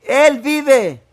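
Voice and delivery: high, loud triumphant shout; shouting he lives. Retold or imitated. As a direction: cry it out in joyful triumph. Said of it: "él comenzó a gritar".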